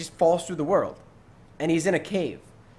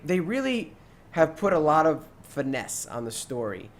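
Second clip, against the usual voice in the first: ordinary talk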